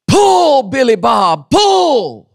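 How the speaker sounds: high shouted farmer's holler; hollering at a mule. Retold or imitated. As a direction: yell at the mule to pull. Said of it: "and he would holler".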